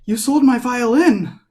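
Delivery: high, loud accusation; outraged shout. Acted. Bea storming back at the buyer, shrieking outrage. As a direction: scream it like she's been robbed. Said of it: "Bea walks back in and goes".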